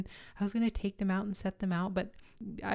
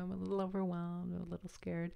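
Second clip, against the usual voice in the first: timid admission; 7 dB quieter than usual. Retold or imitated. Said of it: "But I just said".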